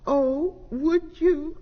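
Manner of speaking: high, slow hopeful question; light up with hope; hopeful cry. A narrator's reading. Lost cat answering the owl, high and hopeful. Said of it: "cried Snoopy Cat".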